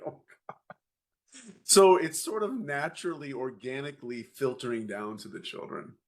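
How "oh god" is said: soft breathless whisper of exasperation; barely voiced, half-amused dismay; whispered oh God. Dad reacts to son's vent plan, whispered dismay.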